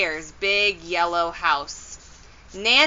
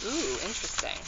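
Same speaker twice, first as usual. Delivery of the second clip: hushed aside; 11 dB quieter, much faster than usual. Real, not acted.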